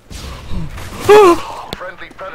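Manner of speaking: loud, sharp gasp; big audible gasp; startled gasp. Real, not acted. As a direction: suck in air in sudden shock. Startled during gameplay.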